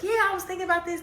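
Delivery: high, fast mock-innocent chatter; mock breezy self; high chatty self. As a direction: play herself breezy and unaware, fast.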